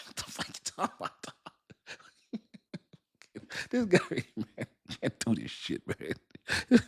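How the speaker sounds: helpless real laughing fit while talking; cracking up. Real, not acted. Cracking up at the comment.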